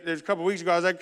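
ordinary talk

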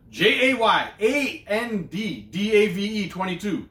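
each letter spaced out slowly; slow spelled-out handle. Real, not acted. Host spelling the handle slowly for viewers, deliberate.

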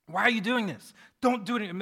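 acted-out dismayed scribe; exasperated protest. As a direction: beg him to stop, exasperated. Acts the appalled scribe objecting.

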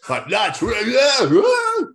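loud, high grunting lad voice; gym lad grunting. Retold or imitated. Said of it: "I'm feeling a bit like".

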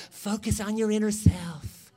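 put-on, level guru voice; mocking self-help guru. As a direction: parody self-help advice. Mocks self-help books.

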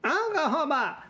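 high creature gibberish call; high-pitched blob-creature babble; blue creature voice. Acted. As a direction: blurt it high, like a little creature. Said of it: "he goes".

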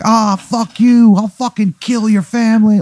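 high, loud fan rage; over-invested heckling. Acted. Date yells at the bad guy, really into it. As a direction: scream threats like a rabid wrestling fan. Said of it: "she's, like, really into it".

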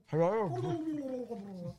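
hammed-up greeting in a character voice; put-on character hello. Player trying out the character's greeting, silly high voice.

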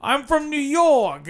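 high, slow New York caricature; imitating a guide's exaggerated New York voice; mock New York accent. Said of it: "that's how he talked".

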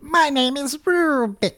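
high slow cartoon character voice; squeaky little cartoon cube. Voicing Rubik the cube.

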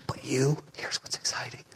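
breathy hushed secret; confiding whisper to the crowd. Real, not acted. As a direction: lean in and whisper it. Hushed aside to the audience.

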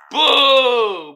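loud drawn-out boo. Real, not acted. Jeering at the scammers, a loud long boo. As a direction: boo them like a crowd.

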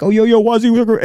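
hyped radio intro voice. Retold or imitated. Imitating Waz practicing his show intro, hyped high. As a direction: hype the intro like a DJ. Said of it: "I was like".

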